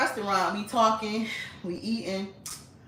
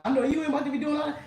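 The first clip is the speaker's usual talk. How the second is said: stepdad voice; 8 semitones higher, 3 dB louder, much faster than usual. Retold or imitated. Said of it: "he like".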